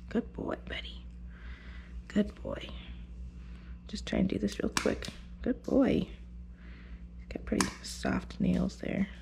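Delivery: soft whisper to a nervous dog; whispered soothing. Real, not acted. Groomer soothing the dog during nail clipping, whispered. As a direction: keep it hushed so he stays still. Hushed while clipping nails.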